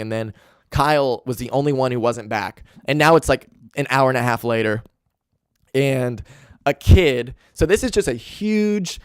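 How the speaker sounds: usual speaking voice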